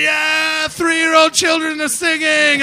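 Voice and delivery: high, loud mock cheer; drawn-out yell of a delighted audience; cheering crowd. Imitating festival crowd cheering his children on stage, high mock yell. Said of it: "And everyone's going".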